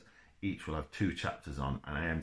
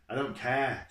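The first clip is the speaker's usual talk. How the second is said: higher, louder flash of anger; snapped; 7 semitones higher, 6 dB louder than usual. A narrator's reading. Boy snapping back at the coach driver, angry. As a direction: snap it back, raised and sharp. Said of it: "Jack snapped".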